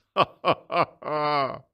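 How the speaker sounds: high, loud gloating laugh; a smug tyrant's laugh; emperor's chuckle. Acted.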